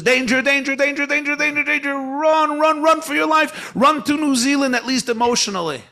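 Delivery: high, loud frantic alarm shouts; shrieking internal alarm urging flight; panicking amygdala alarm. Retold or imitated. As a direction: sound the brain's panic siren, yelling at full pitch. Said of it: "Your amygdala is firing off all these messages".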